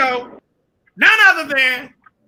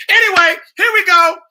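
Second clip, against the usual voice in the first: hype-up announcer; 6 semitones higher, 7 dB louder than usual. Real, not acted. Host hyping the show start.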